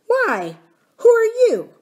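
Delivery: high, loud indignant question; offended nurse. A narrator's reading. Offended nurse to an over-familiar miner boy, indignant. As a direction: take offence and challenge the stranger. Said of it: "offended at the freedom".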